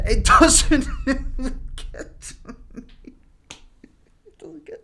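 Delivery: slow, laughing-crying denial fading to a whisper; a faked collapse into sobs; mock breaking down. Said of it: "breaking down mid-denial".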